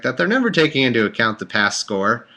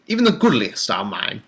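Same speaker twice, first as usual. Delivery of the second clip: rough mock-British Bible reading; badly faked British narrator; put-on British accent. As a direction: slip into an amateur British accent. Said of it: "'cause I can't do a British".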